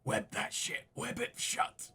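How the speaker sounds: hushed, unvoiced prompt; whisper. Real, not acted. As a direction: whisper it as a quick aside to a teammate. Player whispers advice to teammate.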